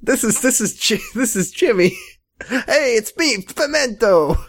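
high, hammed-up Italian New Yorker; fake Italian accent. Imagined New York boyfriend introduced, fake Italian accent. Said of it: "sounded like it was pretending to be Italian".

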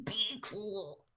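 very soft clenched-teeth mutter. Retold or imitated. Showing how Jillian warned Sally, hushed clenched teeth. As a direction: say it under your breath, teeth clenched. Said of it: "clenched teeth all the way".

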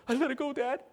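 high-pitched imitation of his son; son answering his dad. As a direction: answer Dad in a put-on youngster's voice. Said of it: "Son, did you let it go?".

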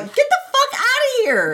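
mock furious yell. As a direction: snap at the archer in a put-on rage. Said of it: "He's like".

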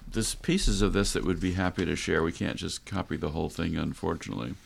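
normal talking voice

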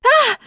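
sharp high gasp of surprise; surprised cry. A narrator's reading. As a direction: yelp in surprise. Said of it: "I cried in surprise".

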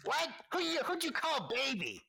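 very high, indignant squeak; offended gnome. Acted. Gnome offended by the nickname, very high indignant squeak. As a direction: bristle at the insult. Villains trading insults.

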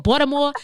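Baltimore said as "Balmore", the t dropped; Baltimore accent. Shown as a demonstration. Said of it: "They don't pronounce they T's".

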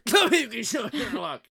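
hoarse, strained, sickly-sounding voice. Acted. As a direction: croak it out like you're ill. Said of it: "You sounded a little sick there".

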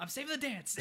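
high-pitched mock-heroic announcement; zealous declaration. Counselor saving the dance, playfully overexcited. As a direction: proclaim it as a man on a mission.

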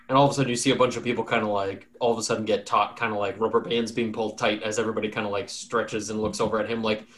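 ordinary talk, nothing marked

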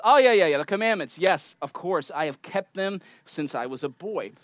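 eager, dismissive "yeah, yeah" as if it's easy; breezy overconfidence. Acted. Young ruler answers Jesus about the commandments, breezily sure of himself.

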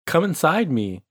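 mock seductive whisper-like line; a hint of seductress; sultry voice. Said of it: "sultry female voice".

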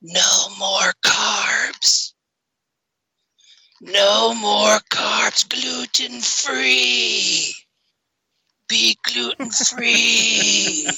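slow, drawn-out spooky moaning; ghost voice. Pretend. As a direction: wail it like a ghost on a recording. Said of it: "they're gonna hear".

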